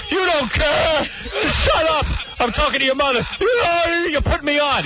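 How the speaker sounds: high, ranting imitation of dad; screaming father. Father accusing his son of mocking him, mock screaming. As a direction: rage at the kid in a strained high pitch, hammed-up. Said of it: "He'd start screaming".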